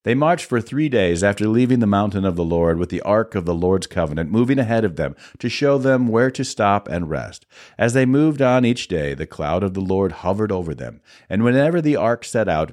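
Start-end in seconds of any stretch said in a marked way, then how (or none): none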